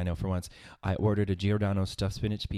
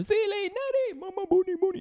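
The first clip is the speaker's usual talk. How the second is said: squeaky high falsetto, laughing through it; high falsetto quote. Retold or imitated. Host imitating the movie character in high falsetto. Quoting character from The Color Purple.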